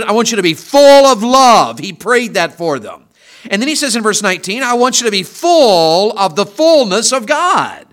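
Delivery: impassioned pulpit reading of the prayer; full-force preaching. Acted. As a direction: drive the verses home to the congregation.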